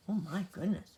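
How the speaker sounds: ordinary delivery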